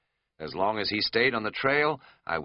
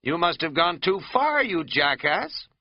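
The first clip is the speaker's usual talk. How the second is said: cross with himself; 9 semitones higher, 4 dB louder than usual. Retold or imitated. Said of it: "I was getting a little cross with myself".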